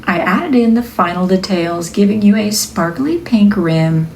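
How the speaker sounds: upbeat narration for a product video; video voiceover. Acted. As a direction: read it like a cheerful product reveal. Reads the product voiceover script.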